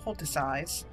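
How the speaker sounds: "Poulticize" ending in "size"; playfully wrong "Poulticize", last syllable like "eyes"; mock pronunciation. Shown as a demonstration. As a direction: say "Poulticize" to rhyme with "exercise". Playfully mispronouncing poultices after saying it.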